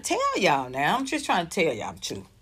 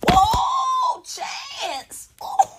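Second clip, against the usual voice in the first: high delighted shout of his name; excited cry; much slower than usual. Real, not acted. Reacting to Chance's tweet.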